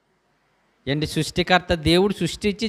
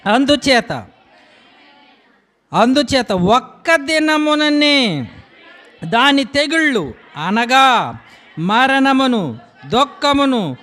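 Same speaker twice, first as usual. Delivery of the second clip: raised, booming scripture reading; preaching at full force; 5 semitones higher, 4 dB louder, slower than usual. Acted. Preacher to congregation reading judgement, booming preaching. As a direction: proclaim the doom of the city.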